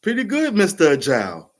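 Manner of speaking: high, loud, admiring lady voice; impressed supervisor. Retold or imitated. Supervisor praising the submitted project, admiring high voice. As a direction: sound pleasantly surprised, like a boss. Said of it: "she was admired".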